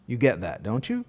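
normal talking voice

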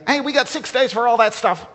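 high, loud, fast scolding; mock indignant leader shouting back; shouting indignantly. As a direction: shout over her praise. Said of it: "this guy is repeatedly saying".

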